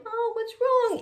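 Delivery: high-pitched innocent question; baby's voice. Retold or imitated. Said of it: "the baby comes over and is going".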